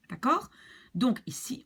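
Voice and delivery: normal talking voice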